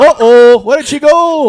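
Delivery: loud, high Scottish radio DJ; mock Scottish accent. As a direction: shout the joke in broad Scottish. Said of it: "street jokes with Scottish accents".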